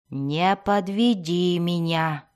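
usual speaking voice

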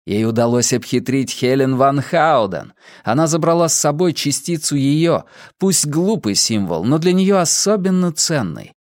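plain reading voice

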